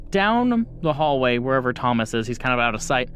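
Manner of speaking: usual speaking voice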